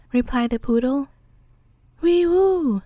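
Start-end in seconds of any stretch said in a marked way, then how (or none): 2.0-2.8 high loud whistle; whistling. Acted. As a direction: whistle it like the wind. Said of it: "whistled the giant of the North Pole".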